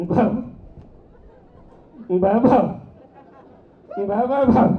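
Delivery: loud dog barks; barking dog. Retold or imitated. Krishna's house dog barking at a stranger, loud barks. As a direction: bark loudly like a guard dog. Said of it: "ఇలా అరుస్తుంది".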